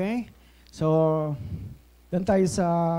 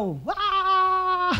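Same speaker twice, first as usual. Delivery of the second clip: mock shout; 12 semitones higher than usual.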